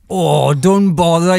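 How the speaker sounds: put-on slow, loud Welsh accent. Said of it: "Great voice there".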